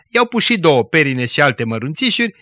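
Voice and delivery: ordinary delivery, nothing marked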